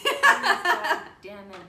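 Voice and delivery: loud, slow, through laughter; laughing plea. Real, not acted. Guest laughs retelling her dread at the customer, loud and slow. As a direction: groan it out laughing, dragging the words.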